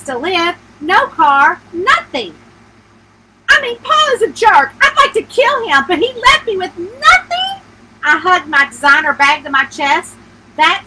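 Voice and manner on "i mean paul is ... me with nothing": high, louder, exasperated rant about the ex; bitter outburst. Acted. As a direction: spit it out, fed up and betrayed. May venting about her ex-husband.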